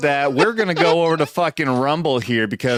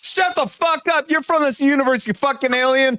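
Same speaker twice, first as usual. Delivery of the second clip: mock outrage; 9 semitones higher than usual. Mock accusing friend of another universe.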